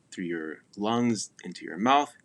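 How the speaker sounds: ordinary talk, nothing marked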